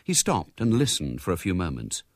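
plain reading voice

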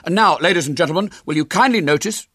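high, loud showman's patter; big, high-pitched presenter voice; young showman William. Acted. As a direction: turn briskly back to the audience. William resumes his show.

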